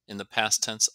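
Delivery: usual speaking voice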